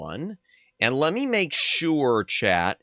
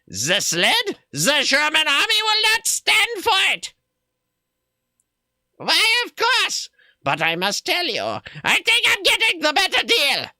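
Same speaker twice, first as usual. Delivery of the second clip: much higher, hammed-up German accent; bad German accent. Streamer voicing the gnome-trader in a bad German accent. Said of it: "We'll do a really bad German accent".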